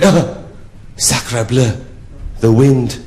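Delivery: mock French exclamation; French accent. French count blown on by the prostitute, in a French accent. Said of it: "he said to me in a French accent".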